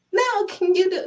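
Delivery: high, insistent mock plea; whining child. Child refusing to lift it alone, whining. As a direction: put on a kid's pleading whine.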